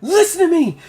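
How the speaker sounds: high, loud pleading voice; urgent NPC woman. Acted. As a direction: urge him fast before it blows. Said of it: "she goes".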